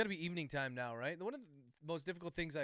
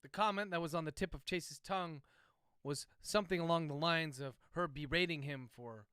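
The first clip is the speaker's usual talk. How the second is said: dictating; 4 dB louder than usual. Real, not acted. Author dictating novel chapter.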